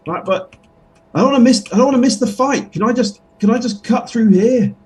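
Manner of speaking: a high voice begging to get past; high pleading character voice. Acted. Character begging the bugbear to let him through, high whiny voice.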